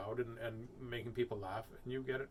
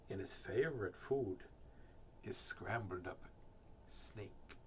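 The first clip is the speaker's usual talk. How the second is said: lower, fading to a whisper; ominous storybook hush; hushed menace; 5 semitones lower, 6 dB quieter, slower than usual. Acted. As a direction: let the threat sink away softly. Mouse hints menacingly at snake's fate.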